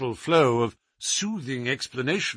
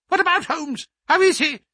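much higher, sharp and tense; high alert voice; 5 dB louder than usual. Acted. The scholar pressing the doctor about Holmes, high and tense. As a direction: snap the question up high, suddenly alert.

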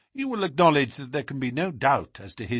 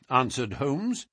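plain reading voice

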